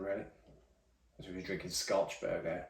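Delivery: normal talking voice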